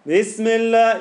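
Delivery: Bismillah slow, loud and relaxed; lazy. Shown as a demonstration. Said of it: "gotta be like a lazy person".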